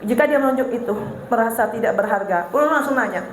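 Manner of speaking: ordinary delivery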